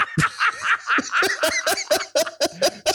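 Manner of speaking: long high burst of laughter; cracking up. Real, not acted. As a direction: laugh hard at the memory. Laughs at the story.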